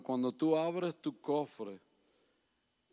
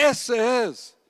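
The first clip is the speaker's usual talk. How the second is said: high, loud call of a woman in church; retold shout; 9 semitones higher, 9 dB louder than usual. Woman in the congregation standing and shouting at the preacher, high and loud. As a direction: call out from the pews, pointing him out. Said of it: "se para otra vez y grita".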